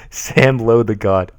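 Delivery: ordinary delivery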